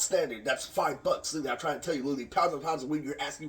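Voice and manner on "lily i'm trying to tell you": ordinary talk, nothing marked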